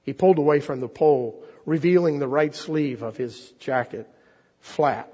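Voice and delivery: expressive storyteller read aloud; story reading. Acted. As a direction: draw the congregation into the tale. Preacher reads The Rag Man aloud.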